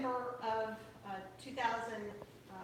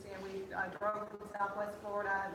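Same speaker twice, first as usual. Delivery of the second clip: usual speaking voice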